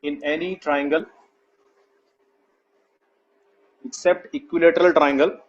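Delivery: slow, word-by-word dictation; drawn-out words for students writing along. Real, not acted. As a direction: spell out the note slowly, pausing long between phrases. Teacher repeats dictation for note-taking.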